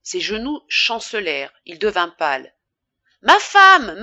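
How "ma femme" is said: much higher, much louder panicked call for help; frantic husband calling his wife down. A narrator's reading. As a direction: shout up the stairs for your wife. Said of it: "appela Charles".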